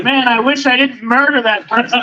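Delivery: high-pitched hammed-up confession; mock yelling to oneself. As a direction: blurt a secret aloud, mock soap style. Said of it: "Yelling to yourself".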